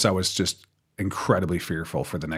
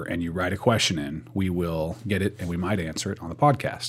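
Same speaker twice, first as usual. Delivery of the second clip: ordinary talk, nothing marked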